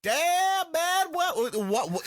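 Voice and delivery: high-pitched mock astonishment; hammed-up comic squadmate. Podcaster imitating the flirty squadmate reacting, high hammed-up astonishment. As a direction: leap up high in mock disbelief.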